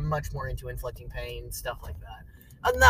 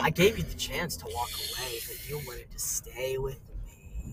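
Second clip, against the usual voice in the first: slow, soft sinister tease; creepy murmur; 2 semitones lower, 7 dB quieter than usual. Pretend. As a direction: play the creepy kidnapper softly. Creepy slow line with music.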